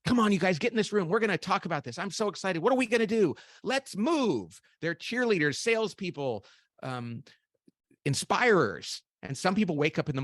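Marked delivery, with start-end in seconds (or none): 0.1-4.6 excited cheerleader. Retold or imitated. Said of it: "they like to go out and go".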